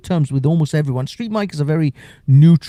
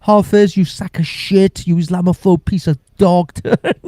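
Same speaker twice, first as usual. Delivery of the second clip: slow, high, louder sneering mock praise; sarcastic mock compliment; 7 semitones higher, 4 dB louder than usual. Troll in the chat mocking the host with fake love. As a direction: ham up a fake compliment that turns to insults. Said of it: "It's like".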